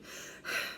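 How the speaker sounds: soft breathy sigh. Acted. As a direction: let out a defeated sigh. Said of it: "Sir George sighed".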